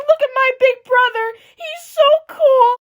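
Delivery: proud little sister. Pretend. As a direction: brag like a proud little kid. Voicing little sister's pride.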